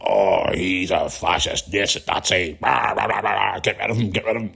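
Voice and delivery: dismissive mimicry of a ranting critic; sneering imitation tailing off; mock online ranter. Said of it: "I don't go on the internet going".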